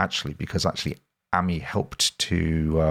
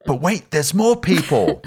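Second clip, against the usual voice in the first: high, excited TV salesman pitch; infomercial host; 8 semitones higher than usual. Pretend. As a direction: sell it like a late-night ad. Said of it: "It sounds like we're doing, like, an infomercial".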